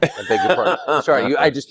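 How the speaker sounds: high-pitched, laughing self-correction; laughing apology. Real, not acted. Interviewer corrects his mix-up to the guest, laughing. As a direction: beg pardon through a burst of laughter.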